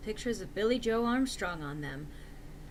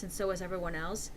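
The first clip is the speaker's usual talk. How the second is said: ordinary delivery, nothing marked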